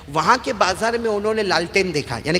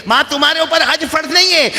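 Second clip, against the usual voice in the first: son's line repeated higher and louder; raised insistent son; 10 semitones higher, 7 dB louder than usual. Retold or imitated. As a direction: insist firmly, raising the voice. (بیٹے کی بلند اور پُرزور آواز)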